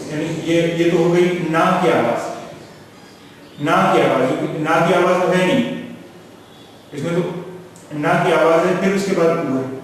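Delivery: ordinary talk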